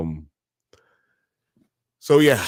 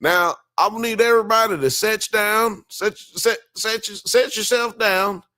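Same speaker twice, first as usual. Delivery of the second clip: put-on high, stretched Southern country accent, a bit slower at the end; Mississippi drawl imitation; 10 semitones higher than usual. Retelling a documentary man's words, in a hammed-up high Southern drawl. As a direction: mimic a Mississippi man telling a crowd to sit, with a thick Southern accent. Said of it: "And one time the dude said".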